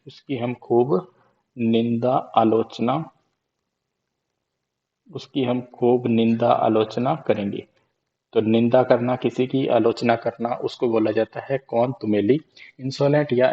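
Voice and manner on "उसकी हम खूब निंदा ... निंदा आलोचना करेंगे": slow drawn-out mnemonic. Shown as a demonstration. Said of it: "repeats mnemonic slowly".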